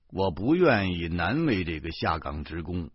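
ordinary delivery

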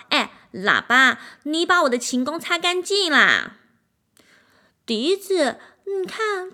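the proud violin bossing the trumpet and flute, lazy and whiny; spoiled arrogant violin. A narrator's reading. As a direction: order everyone around like a spoiled star.

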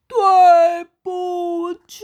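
high, loud, slow shouted 对不起; loud slow apology. A narrator's reading. Bear shouting sorry up to the sky. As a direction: call it up to the sky. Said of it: "对着天空大叫".

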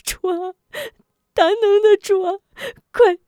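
high, loud keening sobs; wailing in grief. Acted. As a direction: howl in grief and curse them. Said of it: "吴夫人悲痛欲绝".

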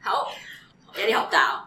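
usual speaking voice

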